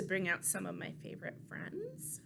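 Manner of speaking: secret whisper; conspiratorial whisper. Acted. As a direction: lean in and whisper it. Confiding to the audience.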